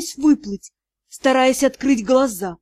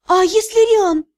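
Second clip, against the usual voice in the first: высокий, тревожный голос девушки; с тревогой спросить высоким голосом; робкий вопрос; 7 semitones higher, 3 dB louder than usual. A narrator's reading. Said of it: "тихо спросила я". (тревожный вопрос, высоким голосом)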